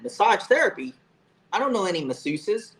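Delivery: mildly hammed-up disbelief; retold incredulity. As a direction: balk lightly at a ridiculous suggestion. Retelling his doubt at cousin's suggestion.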